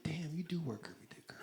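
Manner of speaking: unvoiced whisper to himself; hushed, amused whisper; whispered aside. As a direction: whisper it like you're secretly cheering her on. His own reaction while watching.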